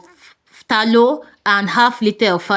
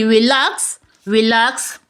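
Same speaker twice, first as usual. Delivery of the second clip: usual speaking voice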